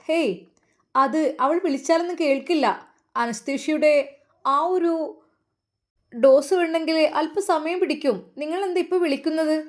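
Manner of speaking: stern nurse. A narrator's reading. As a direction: scold the father like a brusque nurse. Stern nurse scolds the father. (രോഷത്തോടെ ശകാരിക്കുന്ന nurse, ഉയർന്ന ശബ്ദത്തിൽ)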